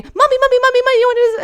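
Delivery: put-on high, loud little-kid voice; a small child pestering mommy, high and loud; imitating her son. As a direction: chirp it like an eager four-year-old tugging at mom. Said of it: "'cause he's telling me".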